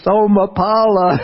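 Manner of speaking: high, loud call into the night; yell a name. Retold or imitated. Monk calls out to neighbour at night, a high loud yell. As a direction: shout for the villager down the hill. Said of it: "I go outside the kuti and I yell".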